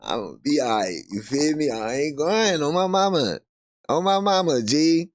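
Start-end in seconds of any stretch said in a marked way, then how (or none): none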